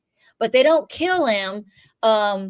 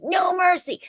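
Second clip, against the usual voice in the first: Johnny Lawrence line; 8 semitones higher than usual. Retold or imitated. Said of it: "be all like Johnny Lawrence".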